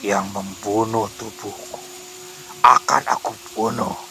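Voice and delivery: slow, eerie ghost voice; haunting, unhurried spirit menace; menacing possessed ghost. A narrator's reading. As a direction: draw the threat out slowly. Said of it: "ucap Ranti sambil tertawa".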